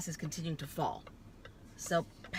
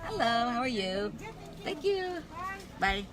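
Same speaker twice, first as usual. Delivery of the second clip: mock cheery operator; 7 semitones higher than usual. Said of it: "they're going".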